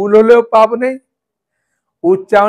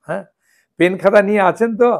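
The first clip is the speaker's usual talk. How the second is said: ordinary delivery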